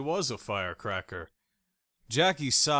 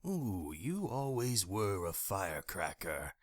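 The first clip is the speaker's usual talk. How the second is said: soft, oily taunting man voice; smug ex; 2 semitones lower, 9 dB quieter than usual. A narrator's reading. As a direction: purr it like a smug creep.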